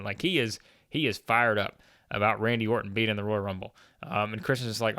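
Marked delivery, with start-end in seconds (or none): none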